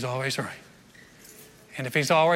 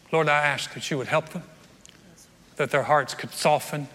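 pastoral prayer; 3 semitones lower than usual. Real, not acted. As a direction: pray gently for the hurting. Leads the closing prayer.

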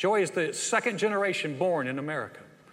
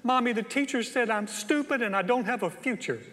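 put-on high, whimpering little-kid voice; crying child; 6 semitones higher than usual. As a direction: play a hurt child complaining to mommy. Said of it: "they came home and they're crying".

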